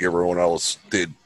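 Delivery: ordinary delivery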